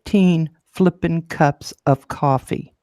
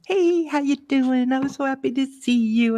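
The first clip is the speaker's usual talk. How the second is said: cheerful barista; 9 semitones higher than usual. Retold or imitated. Barista greeting a regular customer, high bubbly voice. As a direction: gush like an eager teen barista. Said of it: "he goes".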